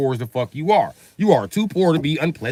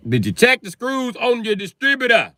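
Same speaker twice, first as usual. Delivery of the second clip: higher, pushing over a bad line; calling over a noisy phone line, raised; raised repeat; 11 semitones higher than usual. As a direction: say it again, higher.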